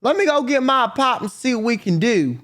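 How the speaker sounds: lightly voiced country son offering to fetch his dad; Bart's country voice. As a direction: sympathize like a rural son. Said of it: "And he's like".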